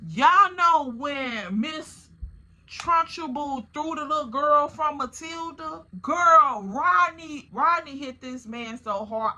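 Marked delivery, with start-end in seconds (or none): none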